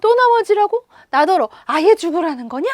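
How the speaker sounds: loud, high, indignant grandfather outburst; sudden anger. Acted. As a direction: flare up in acted outrage. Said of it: "할아버지는 화를 벌컥 내었습니다".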